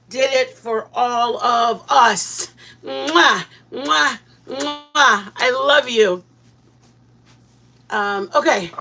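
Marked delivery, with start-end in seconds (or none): none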